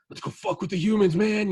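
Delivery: hammered alien bro; frat boy alien. Retold or imitated. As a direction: egg your buddies on like a drunk frat bro.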